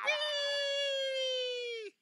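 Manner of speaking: very high gleeful squeal; fly off with a happy shriek; joyful whee. Acted. Pom Pom leaping to fly, squealing with glee.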